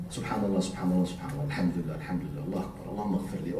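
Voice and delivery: low, quiet murmured dhikr; soft recitation. Shown as a demonstration. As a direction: recite the praises softly to yourself. Quiet recited words of remembrance.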